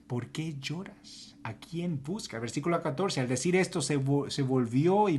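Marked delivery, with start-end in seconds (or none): 0.1-2.4 gentle. Acted. Jesus asks gently.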